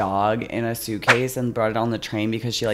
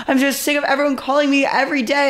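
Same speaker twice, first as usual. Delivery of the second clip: high, fast, angry imitated rant; mock screaming neighbor; 13 semitones higher, faster than usual. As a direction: rattle it off like the fed-up neighbor. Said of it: "screaming at me".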